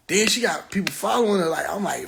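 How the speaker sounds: normal talking voice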